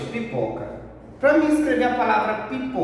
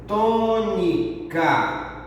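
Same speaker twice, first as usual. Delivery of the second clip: tônica very slow, as tô... ni... ca; syllable by syllable; much slower than usual. Shown as a demonstration. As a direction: stretch each syllable of tônica. Teacher spells out key term. (tônica bem devagar, sílaba por sílaba)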